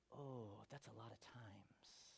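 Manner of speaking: slow, hushed dismay; stunned whisper. Acted. Peter's head spinning at Jesus's answer, stunned whisper. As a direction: whisper it slowly, overwhelmed. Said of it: "you can see Peter's head kinda spinning".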